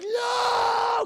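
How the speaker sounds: high anguished scream; anguished shout. Retold or imitated. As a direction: scream in loss. Willie sees robot destroyed.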